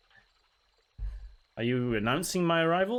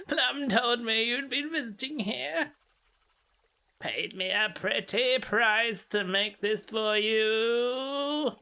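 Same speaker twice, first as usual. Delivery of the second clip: put-on high, slow old-lady voice; old granny voice; 7 semitones higher, slower than usual. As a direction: croon it like a sweet creepy grandma. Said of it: "It's Granny Smith".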